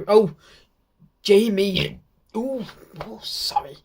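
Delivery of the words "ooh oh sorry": hushed, embarrassed whisper; whispered apology. Real, not acted. Fan catching his own slip, whispering sorry. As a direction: whisper a quick sorry, caught out. Slipped saying her first name.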